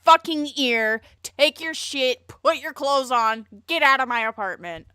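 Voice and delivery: furious mock eviction, each order shouted; mock outraged yell. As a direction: throw him out of the apartment. Acted angry rejection.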